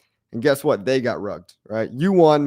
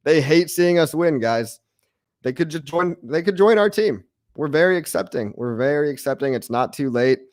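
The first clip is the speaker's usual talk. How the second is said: usual speaking voice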